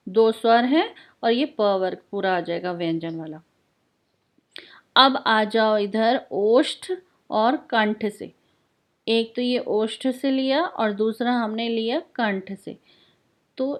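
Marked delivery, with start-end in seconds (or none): none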